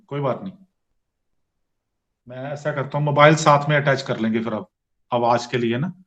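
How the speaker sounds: loud, fast, over a mobile line; harsh phone sound, close to the mic; phone audio. Real, not acted. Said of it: "मुझे mobile पर join करना पड़ा".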